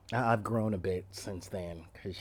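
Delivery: ordinary talk, nothing marked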